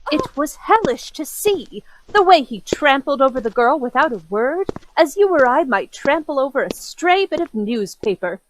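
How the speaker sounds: ordinary talk, nothing marked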